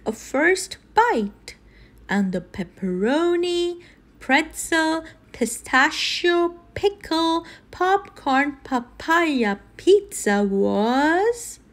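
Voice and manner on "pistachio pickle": ordinary delivery, nothing marked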